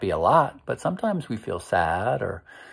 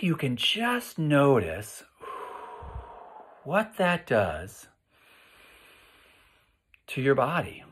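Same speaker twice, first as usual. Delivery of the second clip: guided breathing. Acted. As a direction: guide the kids through it calmly. Leads calming body exercise.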